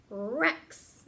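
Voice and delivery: Rex said slowly for the class to copy; slow, deliberate Rex for children to repeat; slow teacher demo. Shown as a demonstration. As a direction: model Rex slowly with a hand motion. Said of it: "Everybody do it with me".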